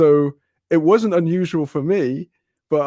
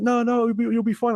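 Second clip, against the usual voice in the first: higher pitched mock reassuring; mock reassurance; 9 semitones higher than usual. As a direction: wave off his fear like it's nothing. Neighbours brushing off spider fear.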